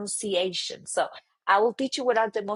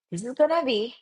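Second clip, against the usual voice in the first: ordinary talk, nothing marked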